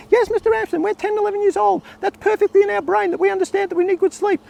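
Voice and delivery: put-on squeaky high, eager boys' chorus; a keen ten-year-old reciting back; excited kids. Boys answering the teacher.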